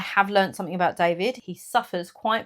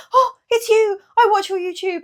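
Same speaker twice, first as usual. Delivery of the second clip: high, bright, gushing fan voice, much higher; excited fan; 13 semitones higher, 7 dB louder than usual. Retold or imitated. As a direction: gush like a delighted stranger. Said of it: "she stopped me and she said".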